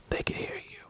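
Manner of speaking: whispered, barely audible; hushed warning. Real, not acted. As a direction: drop to a whisper so nobody outside hears. Wary of neighbour hearing them.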